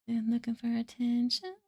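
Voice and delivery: ordinary delivery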